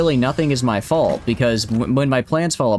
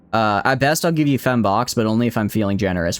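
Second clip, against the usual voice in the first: usual speaking voice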